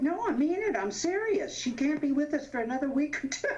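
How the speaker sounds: imitating a flustered host backpedalling fast; high, rushed, earnest protest. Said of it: "Sid got flustered".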